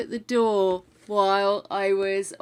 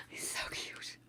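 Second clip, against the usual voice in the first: very soft whispered gush; delighted whisper; 21 dB quieter than usual. Real, not acted. Streamer admiring the present to themselves, delighted whisper. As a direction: whisper it as if overcome by cuteness.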